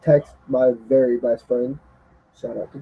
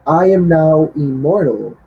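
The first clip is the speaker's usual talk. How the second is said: loud, higher, mock-grand boast; boastful; 5 semitones higher, 5 dB louder than usual. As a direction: puff yourself up like a champ. Mocking Seth's boast.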